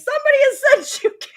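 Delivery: high, fast, laughing read; squealing disbelief; excited retelling. Retold or imitated. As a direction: blurt it out in delighted disbelief. Retelling the surprise.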